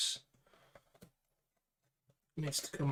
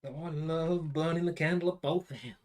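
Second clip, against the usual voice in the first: higher, louder put-on American accent; bad American accent; 4 semitones higher, 6 dB louder than usual. Joking about working late, in a bad American accent. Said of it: "my bad American accent".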